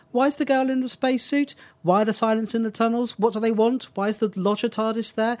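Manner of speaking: high-pitched volley of baffled questions; mock bewilderment. Acting out bewildered stream of questions.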